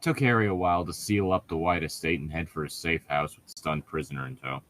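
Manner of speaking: ordinary talk, nothing marked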